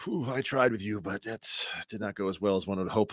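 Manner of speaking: soft, drained sigh of defeat; weary muttering. Retold or imitated. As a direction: let it fade out, tired. Voicing own weary thought.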